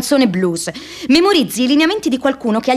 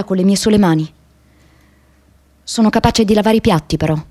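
solemn monologue; 2 semitones lower than usual. Acted. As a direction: lay each line down with gravity. Closing monologue delivered.

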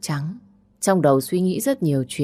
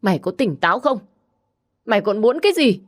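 angry mother; 5 semitones higher, faster than usual. Acted. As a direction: fling the question at her in anger. Mother scolds daughter furiously. (mẹ quát con gái, giọng cao và to)

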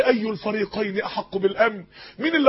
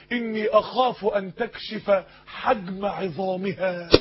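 lower, softer, slow solemn quote; reverent quoted saying; 4 semitones lower, 6 dB quieter, slower than usual. Acted. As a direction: deliver the Prophet's words with hushed gravity. Quoting the Prophet's words.

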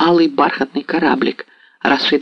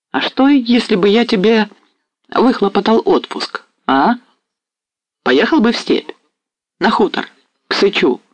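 higher, sly smiling offer; 8 semitones higher than usual. A narrator's reading. Mazepa slyly tempting Ostap with leave, smiling. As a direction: dangle the offer with a knowing grin. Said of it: "произнёс с лукавой улыбкой".

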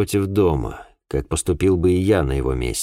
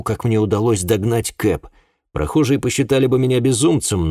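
ordinary delivery

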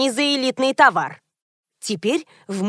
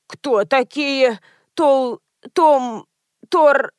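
slow start, then halting false starts; stammering; 3 semitones higher, much slower than usual. A narrator's reading. Old woman trips over a strange pasta name, stammering. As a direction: stumble over the unfamiliar name in broken attempts. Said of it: "начала заикаться старушка".

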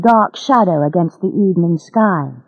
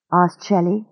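ordinary delivery, nothing marked

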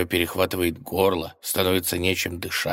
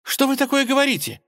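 high, shrill cry of protest; shouting; 4 dB louder, faster than usual. A narrator's reading. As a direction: cry out in outrage. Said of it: "закричала она".

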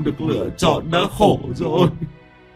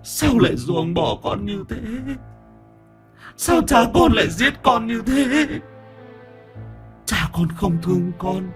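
ghost weeping; 12 semitones higher than usual. A narrator's reading. Drowned boy's ghost crying to his aunt and uncle. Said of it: "Minh lại bật khóc".

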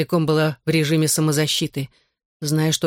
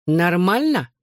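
snide; slower than usual. A narrator's reading. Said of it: "ехидно переспросил он".

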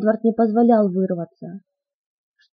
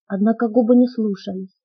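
plain reading voice

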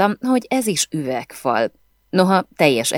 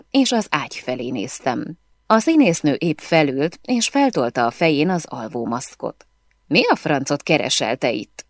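ordinary delivery, nothing marked

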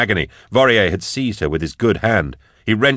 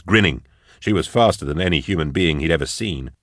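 usual speaking voice

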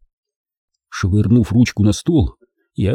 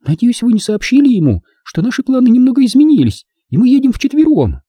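worried, very high female character voice; Тони; 4 dB louder than usual. A narrator's reading. Woman worried that their changed plans were revealed, anxious. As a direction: ask anxiously, pitched high throughout. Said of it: "Тони обеспокоенно оглянулась".

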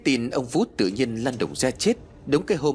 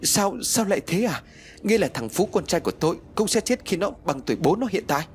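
much higher, stammering alarm; horrified; 8 semitones higher than usual. Acted. Mother terrified her son will die too, stammering. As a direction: stumble out the fear for her son. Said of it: "Bà Phú lại được một phen thất kinh".

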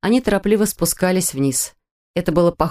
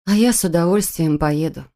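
ordinary delivery

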